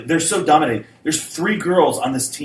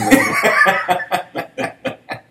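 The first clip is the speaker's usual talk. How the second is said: real laugh; 7 semitones higher, 4 dB louder than usual. Real, not acted. Host amused by his imagined chat, real laughing fit. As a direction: burst of laughter, higher pitched.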